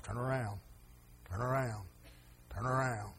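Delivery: soft repeated call. Retold or imitated. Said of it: "has God told us".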